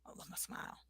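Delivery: a breathy private repeat; barely voiced whisper to the mirror. Shown as a demonstration. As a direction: whisper it to yourself. Repeating affirmation to herself.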